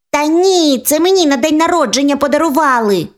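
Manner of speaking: put-on high childish insistence; stubborn little sister claiming the toy; squabbling little girl. A girl insisting mum bought her the bear, high childish voice.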